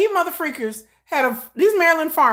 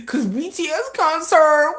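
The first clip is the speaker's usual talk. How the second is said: ordinary talk, nothing marked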